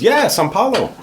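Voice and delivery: high-pitched imitated excitement; delighted driver. Brazilian driver hears his home city guessed, high delighted imitation. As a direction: mimic a thrilled yes, pitch leaping up.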